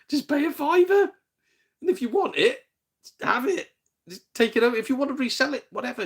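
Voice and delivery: high-pitched, incredulous pleading; exasperated. Real, not acted. Telling car boot hagglers to pay up, exasperated. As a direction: rant with the pitch pushed right up.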